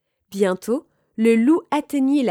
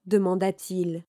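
usual speaking voice

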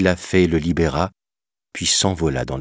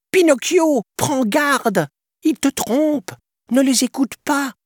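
petit criquet qui crie très aigu et fort; criant à pleins poumons; 4 dB louder than usual. A narrator's reading. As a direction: avertir de toutes ses forces. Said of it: "s'époumonnait en vain le petit criquet". (cri aigu du criquet)

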